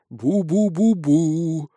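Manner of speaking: very slow ghostly "bu-bu"; long wavering moan, close at hand; eerie booing nearby. A narrator's reading. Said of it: "раздалось уже совсем близко". (протяжное жуткое бубнение рядом)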